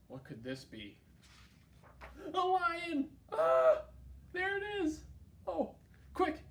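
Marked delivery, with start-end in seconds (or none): 2.3-6.4 high, loud pretend shriek at a lion; hammed-up alarm for little listeners; playful storytime scare; mock fright. Lion appears in picture book.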